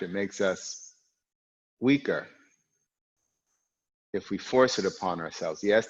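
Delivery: normal talking voice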